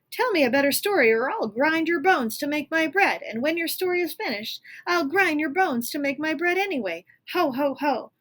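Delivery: high, loud giant threat with laugh; threatening giant. Acted. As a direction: menace him like a giant.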